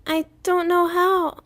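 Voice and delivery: put-on high, slow, tearful child rambling; a boy crying it all out at once; tearful babbling. A narrator's reading. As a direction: son babbling to father through tears. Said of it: "he babbled, crying into his chest".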